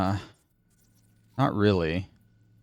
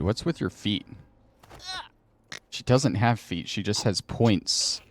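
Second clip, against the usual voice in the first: normal talking voice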